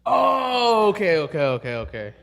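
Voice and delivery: sudden realisation. Real, not acted. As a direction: let it dawn on you, starting way up high. Whispered name finally recognised.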